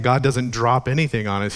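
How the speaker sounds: ordinary delivery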